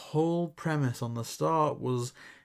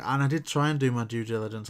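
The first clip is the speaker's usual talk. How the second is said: ordinary talk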